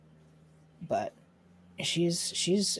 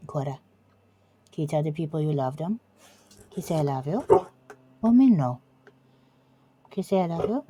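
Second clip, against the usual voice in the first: pet talk; 4 semitones higher, 6 dB louder than usual. Real, not acted. Owner coaxing her dog Cora to say I love you. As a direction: coax the dog sweetly.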